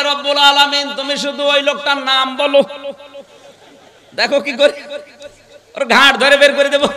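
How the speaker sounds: high, projected demand; retold calling out. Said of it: "ডাক দিয়ে বলে".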